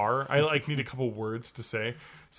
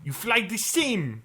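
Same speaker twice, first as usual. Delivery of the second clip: higher, slow mock Russian accent through laughter; 4 semitones higher than usual. Friend doing the high-voiced Russian accent. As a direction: scold it like a comic Soviet teacher. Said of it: "the high voice Russian".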